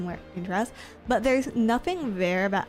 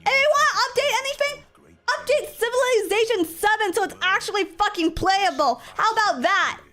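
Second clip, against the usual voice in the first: high, loud outraged ranting; shrieking rant; 13 semitones higher, 6 dB louder than usual. Real, not acted. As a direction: screech at the game in outrage. Ranting at the game.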